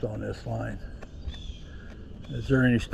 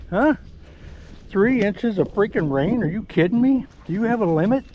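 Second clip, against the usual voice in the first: amazed at the dog; 7 semitones higher than usual. Real, not acted. Handler marveling at his dog after heavy rain. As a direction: tease her with delight.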